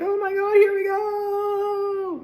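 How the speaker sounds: high, very slow resigned wail; hammed-up dread of being swept along; mock helpless. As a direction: let the horse drag you away. Voicing being carried off.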